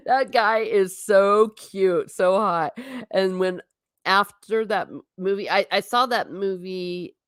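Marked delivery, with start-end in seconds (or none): 0.1-2.7 swooning. Real, not acted. Swooning over the Poldark actor.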